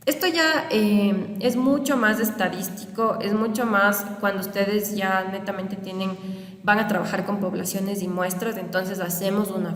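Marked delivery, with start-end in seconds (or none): none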